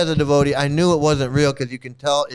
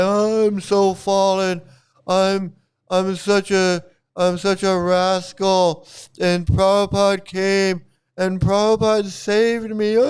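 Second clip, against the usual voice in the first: slow, hammed-up weepy wail; mock tearful breakdown; 3 semitones higher, slower than usual. Imitating his friend's emotional breakdown, slow mock crying. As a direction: drag it out like a fake sobbing confession. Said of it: "having this emotional breakdown".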